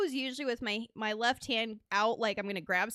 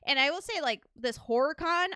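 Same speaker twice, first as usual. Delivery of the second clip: usual speaking voice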